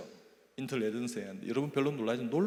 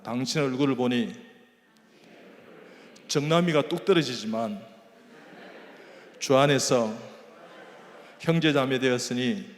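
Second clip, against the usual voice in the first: call to repeat; 9 dB louder than usual. Shown as a demonstration. Preacher leading the congregation to repeat after him, loud. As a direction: lead the crowd in a call and response. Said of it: "자, 따라합니다".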